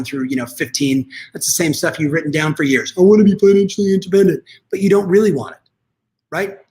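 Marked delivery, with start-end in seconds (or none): 3.0-4.4 louder, higher, rushed mimicry; mock goal-reciting. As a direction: rattle off the stock wish in a raised mock voice. Mimicking audience's insincere stock goal.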